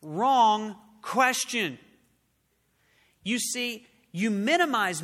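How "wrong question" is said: wrong question, drawn out slow and firm; slow emphatic rebuke. Acted. Preacher to congregation, slow firm rebuke. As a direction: press each word of the rebuke slowly.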